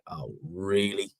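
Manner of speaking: slow, drawn-out scepticism; mock doubtful reaction; cringing disbelief. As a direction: stretch the word out, unconvinced. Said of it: "you're just like".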